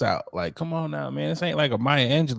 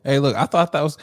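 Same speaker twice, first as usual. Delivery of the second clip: normal talking voice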